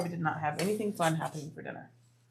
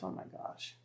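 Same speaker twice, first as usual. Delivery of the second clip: whispered oh my gosh; real startled whisper; shocked whisper; 16 dB quieter than usual. Real, not acted. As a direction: breathe it out in shock. Shocked aside while reading chat.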